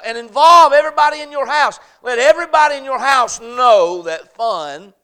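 loud, high, driving sermon delivery; full-force preaching. Real, not acted. Pastor preaching to the congregation, full force. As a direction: preach it out, ringing and urgent.

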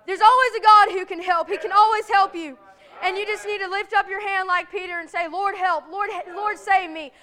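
impassioned preaching. Acted. Preacher assuring sinners that God can always help, impassioned preaching. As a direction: drive the promise home from the pulpit.

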